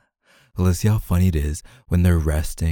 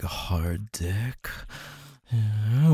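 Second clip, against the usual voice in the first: ordinary talk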